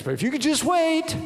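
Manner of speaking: hammed-up embarrassed excuse-making; pleading disclaimer. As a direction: beg a guest to hold out. Embarrassed member begging a guest.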